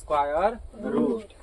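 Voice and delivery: very slow, each word drawn out; slow dictation. Shown as a demonstration. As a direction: spell the title out slowly for the class. Teacher repeats the title slowly.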